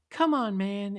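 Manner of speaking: high, loud mocking echo; loud, jeering mimicry of a whine. A narrator's reading. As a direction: throw his plea back mockingly. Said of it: "Eddie said mockingly".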